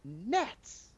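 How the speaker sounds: NETS drawn out high, rising; high, rising emphasis on "Nets"; stressed correction. Acted. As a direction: correct them pointedly on "Nets". Correcting the misheard word nuts.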